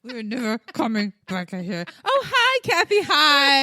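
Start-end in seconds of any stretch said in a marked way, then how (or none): 2.0-3.6 much higher, louder fake-cheerful hello; sing-song mock friendliness; fake sweet greeting. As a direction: switch to syrupy politeness. Sudden sweet greeting to neighbour.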